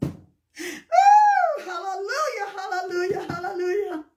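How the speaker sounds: whooped, then repeated, praise cry; overcome with praise. Real, not acted. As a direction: break out in real, high-pitched praise. Overcome with praise mid-testimony.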